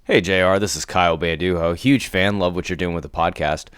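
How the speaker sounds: ordinary talk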